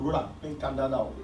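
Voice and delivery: ordinary talk, nothing marked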